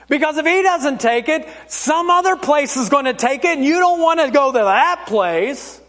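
high, mock-kindly talk to a small child; mock-reassuring grown-up; adult telling a kid. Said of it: "like you're telling the kid".

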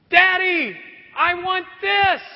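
put-on high, loud child's whine; whiny child. Child whining. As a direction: play a preschooler begging his dad in the store. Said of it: "the preschooler looks up and goes".

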